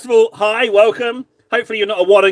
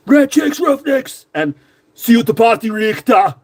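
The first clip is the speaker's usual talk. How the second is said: quoted movie line; 4 semitones higher than usual. Retold or imitated. Quoting film line again.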